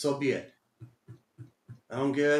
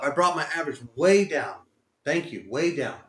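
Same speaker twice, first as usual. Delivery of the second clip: ordinary talk, nothing marked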